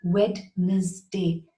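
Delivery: Wednesday as WED-NES-day, D pronounced, three syllables; wrong Wednesday. Shown as a demonstration. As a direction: slowly sound out the wrong Wednesday. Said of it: "it does not have three syllables".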